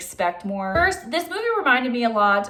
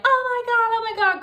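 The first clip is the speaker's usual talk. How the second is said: high, loud mock panic; hammed-up frantic cry; faked panic; 14 semitones higher, 4 dB louder than usual. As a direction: shriek it like a staged panic. She fakes panic finding him.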